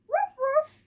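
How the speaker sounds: high, playful woof woof; dog barking. Acted. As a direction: bark it like a happy little dog. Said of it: "said Herbie".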